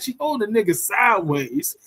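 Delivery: ordinary talk, nothing marked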